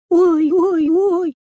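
high, loud little whimpering cries; a scared child squealing; a frightened girl wailing oh oh oh. Acted.

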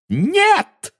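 very high, desperate squeal; shrieking. A narrator's reading. Witch refuses the boy's news, shrieking. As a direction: shriek in denial. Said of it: "взвизгнула она".

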